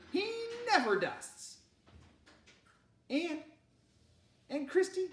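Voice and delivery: high, loud indignant mother; exasperated scolding. Acted.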